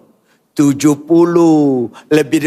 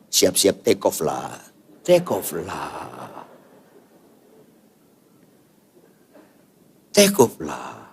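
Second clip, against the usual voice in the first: much lower and softer, fading away; softer and lower on every take off lah; dropping to a murmur; 11 semitones lower, 5 dB quieter, slower than usual. Retold or imitated. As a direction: let it sink to a hush. Mock answer to old pastor.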